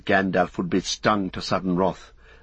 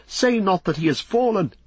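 high cry of dismay; pitched-up, desperate entreaty; dismayed plea; 10 semitones higher than usual. A narrator's reading. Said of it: "cried Beregond in dismay".

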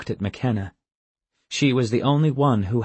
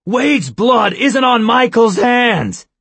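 much higher, louder, slow furious yell; exploding shout; 10 dB louder, slower than usual. Acted. Jack to Ian, defending Michael, furious shout. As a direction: blow up and shout it in his defence. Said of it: "Jack exploded".

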